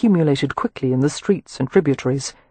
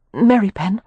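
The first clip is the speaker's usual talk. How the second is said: higher, tense questioning call; tense, slightly raised voice; strained voice; 4 semitones higher than usual. A narrator's reading. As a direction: say the name with strain, pitched up. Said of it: "Her voice was strained".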